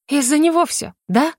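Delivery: high-pitched sharp challenge; sharp interruption. A narrator's reading. Larisa interrupting her younger sister, sharply. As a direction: break in sharply, demanding confirmation. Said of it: "резко перебила её Лариса".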